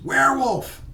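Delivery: high, loud holler of warning; hollered alarm. Acted. Walker hollers in the creepy woods, hollered alarm. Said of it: "one of them hollers".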